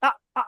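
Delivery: "আ" cut off as if hair is yanked; two sudden glottal catches on "আ"; abrupt, very short "আ"; jolted hamza. Shown as a demonstration. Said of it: "ওই রকম ঝাটকা হবে".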